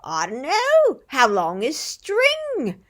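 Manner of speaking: high, loud British retort, slowing right down; British accent. Retold or imitated. Knife sharpener's answer to customers, imitated British accent. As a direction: draw out the punchline in an English accent. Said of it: "he's got a really great accent".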